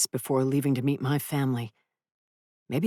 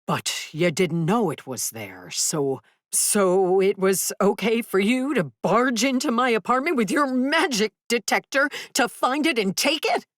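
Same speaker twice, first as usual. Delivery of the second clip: rising to a high yell; 7 semitones higher than usual. Acted. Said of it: "My voice had risen to a yell".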